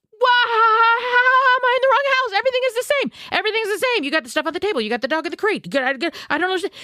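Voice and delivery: high, frantic disbelief rushing at the end; panicked pleading. Retold or imitated. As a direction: shriek in confusion to the owner on the phone. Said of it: "I start screaming in the phone".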